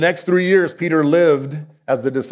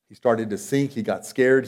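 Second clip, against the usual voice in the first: normal talking voice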